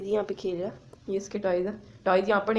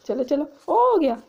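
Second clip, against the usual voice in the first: cheery; 8 semitones higher, 5 dB louder, slower than usual. Real, not acted. Cheerful end with dog.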